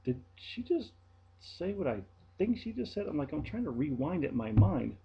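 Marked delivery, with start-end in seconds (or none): 0.0-3.1 high, stunned did-she-just-say-that; stunned rhetorical question; disbelief. Retold or imitated. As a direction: ask it floored, voice jumping up. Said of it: "I'm sitting there going".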